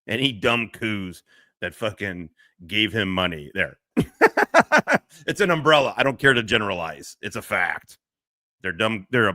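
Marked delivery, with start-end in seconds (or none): 4.0-5.0 loud laugh. Real, not acted. Laughs at own rant.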